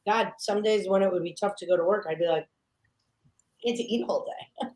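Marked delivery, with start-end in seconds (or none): 3.6-4.8 laughing through the words. Retold or imitated. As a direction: chuckle it out. Retold happy thought, laughing.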